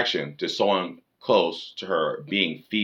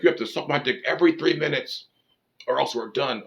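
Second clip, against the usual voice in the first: higher-pitched, hammed-up ultimatum; mock crazy demand; 7 semitones higher than usual. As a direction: play an absurdly demanding man. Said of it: "can't be like".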